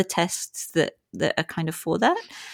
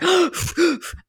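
sharp gasp; 12 semitones higher than usual. Shown as a demonstration. Demonstrating breathwork gasps.

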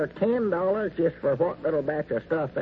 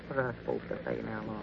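quick, much softer aside off the phone; hushed aside; 5 semitones lower, 8 dB quieter, faster than usual. Acted. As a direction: hurriedly ask a partner out of earshot.

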